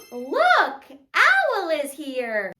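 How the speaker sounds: loud, drawn-out delighted announcement; playful excitement. Pretend. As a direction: show the children an exciting arrival. Announces Owl's pretend arrival.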